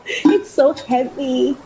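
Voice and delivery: normal talking voice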